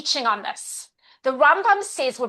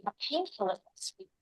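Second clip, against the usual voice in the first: much softer, gentle and sincere; sincere apology; 7 dB quieter than usual. Shown as a demonstration. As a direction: apologize tenderly, almost hushed. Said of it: "You're validating".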